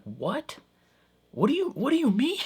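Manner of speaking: high-pitched, rushed, stammering confusion; baffled disbelief. Acted. Vlogger imagining being snapped at by coworker, baffled. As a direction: stammer it out in mock bewilderment, voice shooting up. Reacting as if accused in retold scene.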